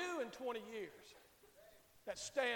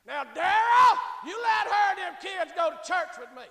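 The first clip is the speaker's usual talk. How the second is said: loud, raised-pitch telling-off; acted scolding; 8 semitones higher, 14 dB louder than usual. Old lady ordering Daryl to let them go, loud scolding. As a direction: lay down the law, full voice. Swan confronts the husband Daryl.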